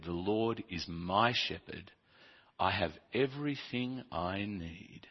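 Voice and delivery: soft, slow psalm reading; scripture reading. Acted. Preacher reads the psalm softly to the congregation. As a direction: read it gently, savouring each line.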